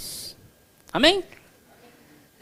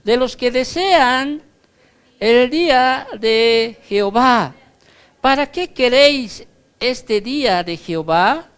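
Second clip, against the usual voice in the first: scripture reading; 5 semitones higher, 5 dB louder than usual. Acted. Reads Amos aloud.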